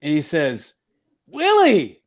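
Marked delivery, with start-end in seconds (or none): 1.3-2.0 high, loud delighted cry of recognition; joyful recognition. Retold or imitated. Uke realizes he's talking to Willie Mays, high delighted shout. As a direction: shout the name as it suddenly clicks.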